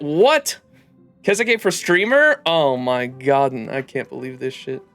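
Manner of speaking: ordinary talk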